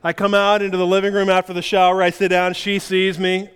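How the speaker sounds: normal talking voice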